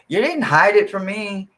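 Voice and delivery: normal talking voice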